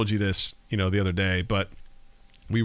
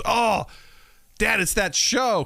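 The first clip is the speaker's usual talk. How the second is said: mock excited kid, pitched up; 10 semitones higher, slower than usual. Daughter spots a show on dad's screen, excited. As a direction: imitate a child spotting a favorite show.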